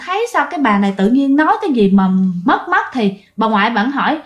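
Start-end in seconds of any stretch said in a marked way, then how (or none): none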